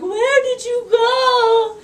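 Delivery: high, slow, tearful plea; imitating the friend in tears, stretched out; 1 crying friend. Retelling a sobbing phone call, high and tearful.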